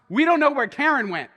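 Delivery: put-on girl's alarmed report. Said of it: "one of them comes".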